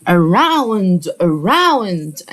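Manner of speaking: around with a clear aw sound, said twice as a model; modelled pronunciation. Shown as a demonstration. Teacher showing kids the ou sound in around. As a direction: stress the aw in around for the class. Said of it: "ال O وال U بننطقها آو".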